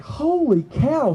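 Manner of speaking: high, slow, drawn-out astonishment; retold amazement. Investigator finding the Coke bottle, amazed. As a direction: stretch it out in wonder.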